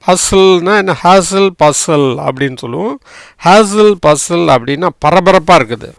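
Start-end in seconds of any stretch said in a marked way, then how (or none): none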